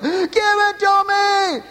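high, loud, slow mock scream; retold yell. Pastor reenacting yelling at God at home, screaming. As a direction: bellow the demand at God. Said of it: "started screaming and yelling".

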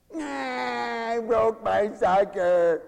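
mock whimpering kid, slow and whining; whiny child. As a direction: whine like a little kid who broke his candy. Child whining over sucker.

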